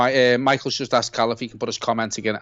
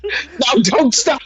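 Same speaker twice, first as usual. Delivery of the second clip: much higher, bursting into laughter; laughing; 3 dB louder, much slower than usual. Real, not acted. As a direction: laugh through the words, overjoyed. Mate laughing, cutting in on him.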